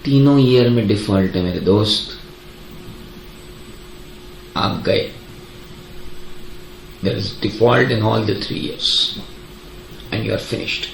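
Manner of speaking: low, soft, slow verdict; mock doom. Teacher telling student all three years default, low ominous mock verdict. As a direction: deliver the sentence like a grim mock judge.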